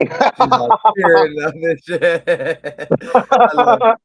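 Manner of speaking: chuckling as you tease her; laughing. Real, not acted. Teasing crying cohost, laughing.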